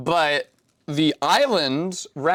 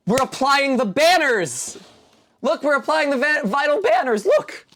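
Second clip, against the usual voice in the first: mock excited; 12 semitones higher, 4 dB louder than usual. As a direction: hype it like a grand ceremony. Playfully hyping the build.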